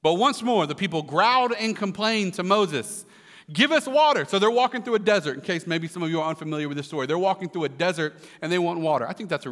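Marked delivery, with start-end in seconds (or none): 3.5-4.2 high, loud demanding cry; mock wail. A narrator's reading. Thirsty people complaining to Moses, mock wailing. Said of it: "they wailed".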